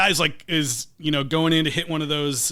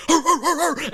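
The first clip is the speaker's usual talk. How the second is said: high, hammed-up caveman grunts; mock jock grunting while chewing; grunting jock noises; 12 semitones higher than usual. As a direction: grunt like dumb jocks eating meat. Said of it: "start going like".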